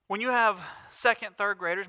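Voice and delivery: normal talking voice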